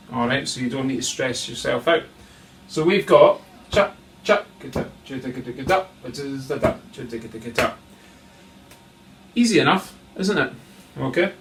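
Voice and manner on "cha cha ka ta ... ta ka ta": spoken drum pattern; vocalised drum rhythm. Shown as a demonstration. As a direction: imitate snare strokes in syllables. Drum teacher voices the rhythm.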